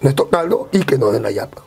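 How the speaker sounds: low conspiring friend. Retold or imitated. Friend plotting in the story.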